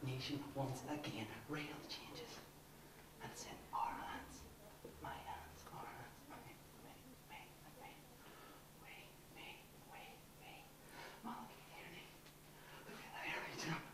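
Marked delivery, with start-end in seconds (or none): 3.2-10.5 barely audible repeating whisper; fevered whisper. Acted. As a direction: mutter it over and over, lost in it. Whispered chant to himself.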